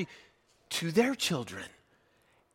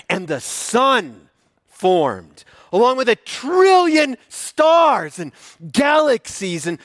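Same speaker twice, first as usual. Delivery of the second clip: booming biblical narration; 7 semitones higher, 6 dB louder than usual. Acted. Builds the creation list.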